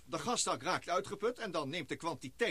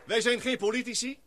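louder, higher, hammering wij; emphatic; 7 semitones higher, 5 dB louder than usual. Acted. Ross insists to the assembly, emphatic raised voice. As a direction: drive the point home to the room.

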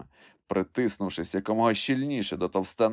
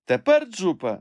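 high, loud excited call; excited shout; 10 semitones higher, 5 dB louder than usual. A narrator's reading. Master shouts up to his climber, excited call. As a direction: cry out to someone above in excitement. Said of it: "збуджено закричав Легран".

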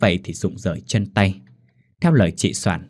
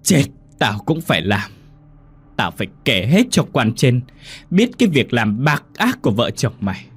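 put-on defiant old mother; 6 semitones higher than usual. Old mother defies her cruel son, rising into a furious cry. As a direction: spit it back at the son, swelling into fury. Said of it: "cắt lên".

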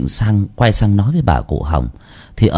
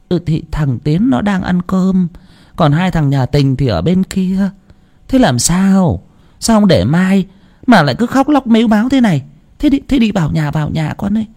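mildly voiced grandmother; puzzled grandma; 9 semitones higher than usual. A narrator's reading. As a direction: soothe the weeping neighbour.

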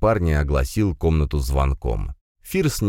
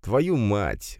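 higher-pitched muttered curse; grumbled curse; 5 semitones higher than usual. A narrator's reading. Birthday boy curses at eager guest, grumbling. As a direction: mutter a curse under your grin-less breath. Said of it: "буркнул именинник".

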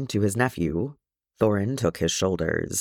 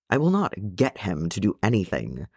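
ordinary delivery, nothing marked

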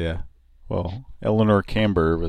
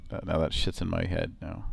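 ordinary talk, nothing marked

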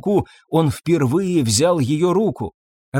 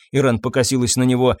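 plain reading voice

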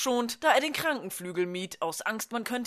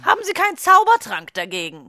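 higher and louder, needling; sarcastic jab; 8 semitones higher, 7 dB louder than usual. Acted. Nurse needling the potions teacher, loud and high. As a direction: jab at her with a loud sneer.

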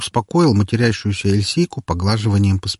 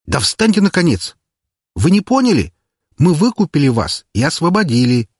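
much higher, exasperated call; exasperated shout; 8 semitones higher, 3 dB louder than usual. Acted. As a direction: get them off the floor, exasperated. Urging slaves to stand up.

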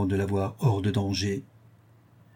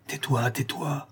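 slow, softer and higher hush; urgent hushing; 4 semitones higher, 5 dB quieter, slower than usual. A narrator's reading. Martial silences la Louve before the gentlemen, hushing.

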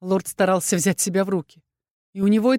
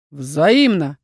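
lower, louder barked word; 4 semitones lower, 4 dB louder than usual. A narrator's reading. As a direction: snap it back at her. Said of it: "рявкнул он".

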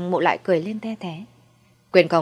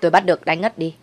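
usual speaking voice

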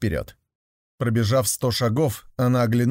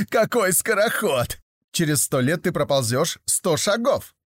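mocking horse voice; 5 semitones higher than usual. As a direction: tease the snail from above. Horse mocks the snail. (насмешливый голос лошади)

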